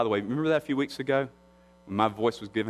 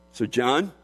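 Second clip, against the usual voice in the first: ordinary talk, nothing marked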